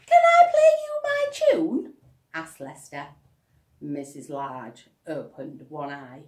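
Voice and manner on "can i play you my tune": put-on much higher, louder boy's voice; an eager little boy asking; eager child. Lester asking mother.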